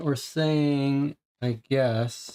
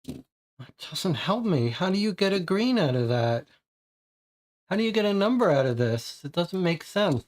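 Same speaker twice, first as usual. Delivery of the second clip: higher, fast, exasperated questions; 6 semitones higher, faster than usual. Real, not acted. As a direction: throw up your hands at the puzzle. Baffled by the puzzle.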